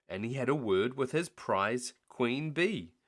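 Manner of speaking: ordinary delivery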